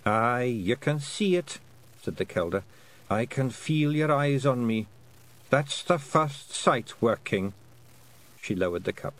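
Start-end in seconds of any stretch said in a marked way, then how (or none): 0.0-7.5 put-on higher Scots voice of a wee old woman; a sly old Scottish matriarch sniffing out a treat; lightly voiced old Scottish woman, teasing; old Scots Kelda. Kelda to Tiffany.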